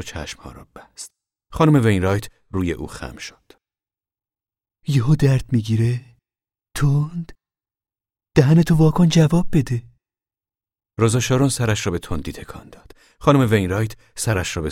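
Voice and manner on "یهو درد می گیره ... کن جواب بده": a lightly voiced brisk older neighbour, higher and louder; voiced Mrs Wainwright. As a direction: press the girl for an answer like a no-nonsense midwife. Mrs Wainwright questions the girl.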